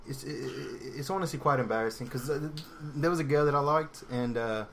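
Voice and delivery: put-on halting British accent drifting towards Australian; dodgy British accent. Podcaster telling an embarrassing crush story, Australian-sounding British accent. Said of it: "it sounds more Australian than".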